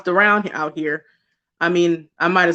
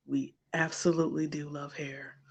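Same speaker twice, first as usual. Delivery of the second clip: softer, conspiratorial whisper; 4 semitones lower, 15 dB quieter than usual. Real, not acted. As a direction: whisper it like a guilty secret. Confiding love of hair.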